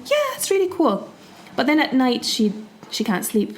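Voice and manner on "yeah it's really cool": pleased wife. Acted. Wife approving her new hut to her husband, high and pleased. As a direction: lightly act a satisfied wife, pitched up. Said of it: "And she's like".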